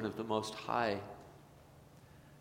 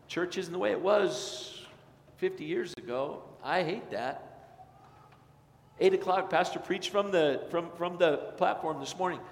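higher, louder grumbling with a touch of mockery; mock entitled complainer; 6 semitones higher, 5 dB louder than usual. Pastor mocking an entitled churchgoer griping, whiny. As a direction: parody a churchgoer who feels owed.

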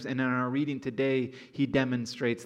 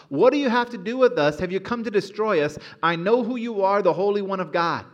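high, loud demon scream; 6 semitones higher, 7 dB louder than usual. A narrator's reading. Preacher voicing the demon screaming at Jesus mid-class, high and loud. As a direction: shriek it out at Jesus. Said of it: "screaming at the top of his lungs".

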